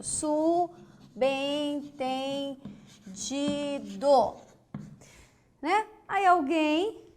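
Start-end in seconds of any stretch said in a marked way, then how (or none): 0.0-4.4 "Subentendido" very slowly as su, ben, ten, di, do; syllable by syllable. Shown as a demonstration. Teacher writing the word alongside, very slow stretched syllables. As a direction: draw out every syllable of "Subentendido". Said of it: "Vou colocar aqui do ladinho".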